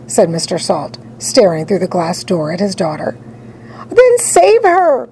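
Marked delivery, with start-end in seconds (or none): 3.9-5.1 high, drawn-out plea; crying out. A narrator's reading. Mother begs her husband to rescue daughter, high cry. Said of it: "cried Missus Salt".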